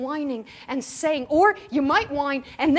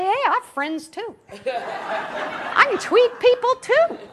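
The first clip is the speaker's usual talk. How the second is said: mock indignant; 9 semitones higher, 5 dB louder, slower than usual. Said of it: "I say".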